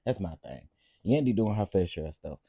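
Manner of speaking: usual speaking voice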